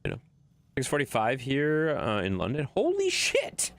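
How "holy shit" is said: sudden high shocked yelp; shocked exclamation. Real, not acted. Streamer reacting to a surprising chat message, shocked yelp. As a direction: blurt it out, caught off guard.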